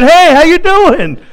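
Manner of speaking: loud, high, chummy hello; breezy greeting. Acted. Dead man showing up at the house, breezy greeting. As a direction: call out high and casual, as if nothing happened.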